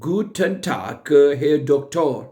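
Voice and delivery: very slow, careful greeting; slow model phrase. Shown as a demonstration. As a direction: spell out each syllable for learners. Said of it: "first के लिए आप कहेंगे".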